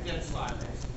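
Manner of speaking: ordinary delivery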